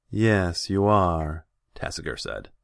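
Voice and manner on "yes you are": loud, drawn-out condescending agreement; imperious, raised voice; lordly khan. A narrator's reading. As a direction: agree grandly from on high. Khan smugly answers the hero.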